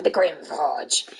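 low, slow GRIM FORGE; ominous line read. Shown as a demonstration. Streamer recalling a recording session, low slow dramatic read. Second take, pitched far lower.

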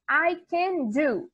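slow, clear I can do; slow English model. Shown as a demonstration. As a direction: model it slowly for the class. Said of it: "a gente diz". (devagar e bem claro)

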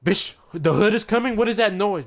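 high, loud alarm at a noise; startled yelling; alarmed outburst. Real, not acted.

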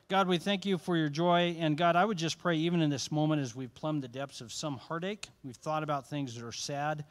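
loud, earnest pastor's closing prayer. Real, not acted. Pastor closing the evening in prayer, earnest and loud. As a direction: lift the room to God with full voice.

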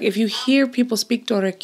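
ordinary talk

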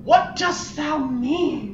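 put-on high, slow old-fashioned dramatic question; mock archaic hero. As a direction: ham up a knight's dismay.

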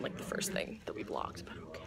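hushed whisper. Real, not acted. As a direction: whisper it as an aside. Whispered aside.

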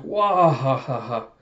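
hammed-up character laugh; imitated hearty laugh. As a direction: belt out a pretend big laugh, then let it fall. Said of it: "And Oden's like".

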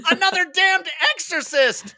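high, loud alarmed scream. Acted. Stables spotting another exorcist, loud scream. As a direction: scream the warning. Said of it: "screamed Stables".

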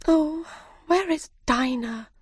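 put-on high, yearning child's call; longing Alice. Alice misses her cat, high and wistful. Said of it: "said Alice".

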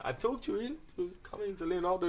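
imitating a Swedish girl on the phone in a bad Swedish accent; hammed-up Swedish chef-style accent. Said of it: "Is that your Swedish accent?".

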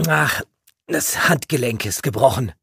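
high, loud, strained with pain; pained groan into words; pain-distorted voice. A narrator's reading. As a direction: force it out, hurting. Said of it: "sagte er mit schmerzverzerrter Stimme".

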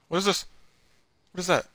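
startled high gasping question; jumpy, spooked and on edge; scared horror game streamer. Real, not acted.

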